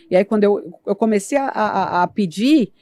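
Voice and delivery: ordinary delivery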